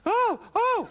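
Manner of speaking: high, loud mock moans; loud comic imitation of moaning; imitated fake orgasm. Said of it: "fake orgasm scene".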